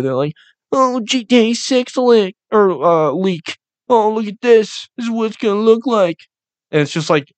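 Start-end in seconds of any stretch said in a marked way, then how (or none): 0.7-6.2 high-pitched mocking nerd voice; mock internet nerd. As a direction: imitate an overeager fan showing off a leak. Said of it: "these fucking nerds on Twitter".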